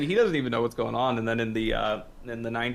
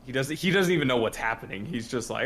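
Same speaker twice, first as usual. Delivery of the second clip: ordinary talk, nothing marked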